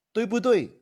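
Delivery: high rising "对不对" in Mandarin; Mandarin question tag. Shown as a demonstration. Teacher demos a Mandarin tag, high and rising. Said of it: "This is question tag in Mandarin".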